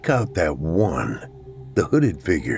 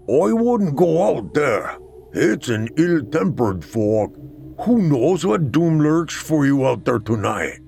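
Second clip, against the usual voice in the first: put-on old barkeep; 6 semitones higher than usual. A narrator's reading. Voices old barkeep Ordug.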